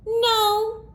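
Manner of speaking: put-on high child's no; a little kid denying it. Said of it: "said the children".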